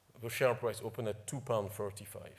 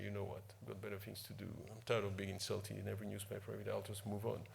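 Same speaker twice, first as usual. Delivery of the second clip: soft, fast, fed-up muttering; a quiet tired aside to yourself; weary inner voice; 2 semitones lower, 10 dB quieter than usual. Retold or imitated. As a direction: say it under your breath, worn out. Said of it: "very tempted to just say".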